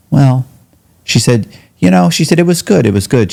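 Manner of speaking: high, light imitation of his mother; mildly voiced mother recounting the funeral; mother's voice. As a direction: lift into mom's voice, breezy.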